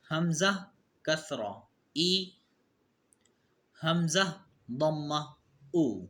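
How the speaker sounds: slow qaida recitation drill. Shown as a demonstration. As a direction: spell each sound out for pupils. Drilling letter sounds aloud.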